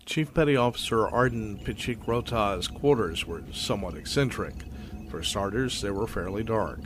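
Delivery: ordinary talk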